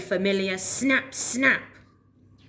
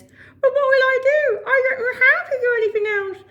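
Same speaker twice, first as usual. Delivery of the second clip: high, loud sobbing wail; desperate crying alligator; 14 semitones higher, 7 dB louder than usual. Acted. Alan despairs.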